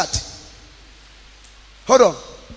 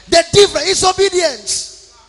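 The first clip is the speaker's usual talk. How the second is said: preaching; 6 semitones higher than usual. Acted. Preaching to the congregation.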